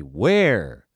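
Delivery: Where hit loud, a held falling Where; hammy stressed Where. Shown as a demonstration. Coach parodying actors, overplayed loud Where. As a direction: lean hard on the single word Where. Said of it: "Maybe it should be".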